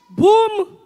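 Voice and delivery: loud, punchy bomb boom; mock explosion boom. Comic mimicking war news, a loud bomb boom. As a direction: burst out a big boom.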